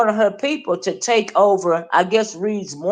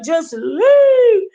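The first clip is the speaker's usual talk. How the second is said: high, loud yelled leave; mock hollering; 12 semitones higher, 4 dB louder than usual. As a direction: shriek it in mockery. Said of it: "Just hollering it".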